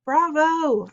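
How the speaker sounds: loud, high cheer; yelling. A narrator's reading. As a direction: yell it out from the audience. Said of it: "I heard Dad yelling".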